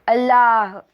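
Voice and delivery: loud answering cry, held back slightly; loud shout. A narrator's reading. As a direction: shout it back, not quite full force. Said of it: "आता मीही जोरात ओरडलो". (जोरात, पण पूर्ण ताकद न लावता ओरडून)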